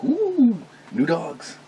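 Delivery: high drawn ooh, then soft; delighted cooing. Retold or imitated. Greeting dogs at a customer's house, delighted coo. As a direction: coo softly at new dogs. Voicing reaction to loose dogs.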